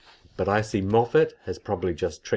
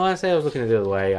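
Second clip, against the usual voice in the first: quick; 3 semitones higher, much faster than usual. Real, not acted. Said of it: "Well, no".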